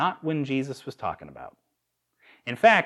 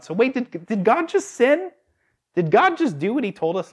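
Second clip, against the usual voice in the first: high, louder feigned alarm; mock shock; 8 semitones higher, 4 dB louder than usual. Preacher feigns alarm to the congregation, high and startled. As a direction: act scandalized for effect.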